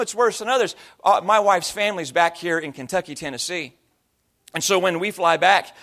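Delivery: normal talking voice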